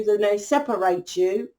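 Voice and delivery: ordinary talk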